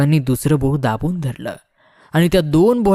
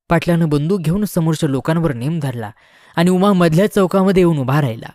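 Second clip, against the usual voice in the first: usual speaking voice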